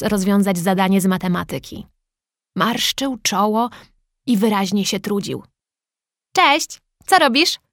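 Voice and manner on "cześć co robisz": bright, high, slow friendly hello; friendly greeting. A narrator's reading. Daniel greets the struggling boy, high and friendly. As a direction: greet the boy gently and kindly. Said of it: "zagadnął przyjaźnie Daniel".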